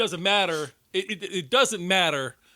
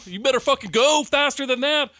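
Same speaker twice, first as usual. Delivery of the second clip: urging yell; 10 semitones higher, 7 dB louder than usual. Retold or imitated. Cheering on a fleeing character.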